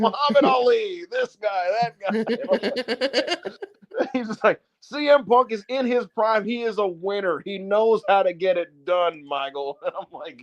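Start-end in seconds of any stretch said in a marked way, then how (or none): 0.0-3.5 high-pitched commentator rattling off legends; name the greats in an excited announcer voice; JBL. Retold or imitated. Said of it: "naming real, like, great, like, legendary athletes". 4.8-9.8 high, slow, emphatic commentator voice; JBL. Retold or imitated. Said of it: "he is commentating for Punk".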